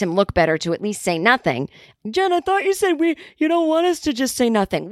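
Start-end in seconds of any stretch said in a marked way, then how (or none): 2.1-4.9 high, put-on whiny sidekick voice; mock Ben Affleck. Affleck whining to Jen after staying silent, high mock voice. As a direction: play it as a meek pal caught out.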